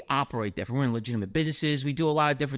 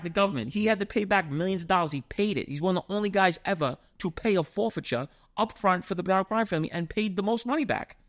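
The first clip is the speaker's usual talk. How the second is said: ordinary talk, nothing marked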